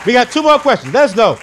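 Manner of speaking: loud, high host hype; hype call out. Real, not acted. Host moving on to the next questions, loud and high. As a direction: pump up the room.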